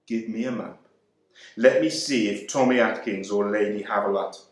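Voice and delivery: ordinary delivery, nothing marked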